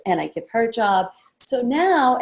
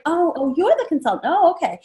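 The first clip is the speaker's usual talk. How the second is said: confused realization; 7 semitones higher than usual. Retold or imitated. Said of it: "now she's a little confused".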